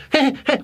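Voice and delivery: high, silly schoolgirl giggle; high school giggle. Retold or imitated. Mocking two women who laughed at his intro, high giggle. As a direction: mimic a snickering high schooler. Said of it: "like high school laughing".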